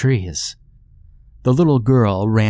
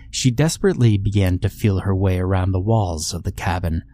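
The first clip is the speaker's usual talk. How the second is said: ordinary delivery, nothing marked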